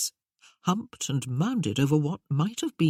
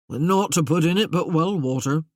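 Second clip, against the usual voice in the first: rustic Merlin voice; 5 dB louder than usual. Acted.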